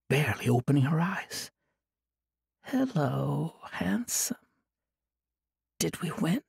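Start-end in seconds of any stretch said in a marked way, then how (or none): 2.6-6.4 quiet, drowsy murmur; soft mumble. A narrator's reading. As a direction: mumble it softly, half awake. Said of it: "Des mumbled softly".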